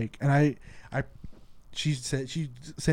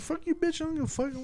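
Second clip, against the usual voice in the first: mock defiance; 9 semitones higher, 4 dB quieter than usual. Driver taunting a rude rider, high mocking sneer. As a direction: sneer it up high like a cocky brat.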